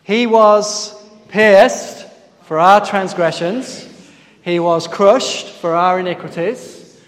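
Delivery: slow, loud, measured memory verse; slow chanted recitation leading the congregation; group recitation. Shown as a demonstration. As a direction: lead the room through the verse, word by word. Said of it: "see if we can say it together".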